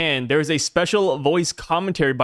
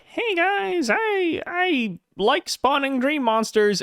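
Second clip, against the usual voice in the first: high nasal mock voice, slow; whiny nasal villain voice, hammed-up; nasally voice; 12 semitones higher, much slower than usual. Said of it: "that nasally voice".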